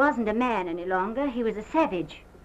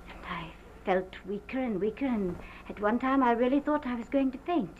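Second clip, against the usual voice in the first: ordinary talk, nothing marked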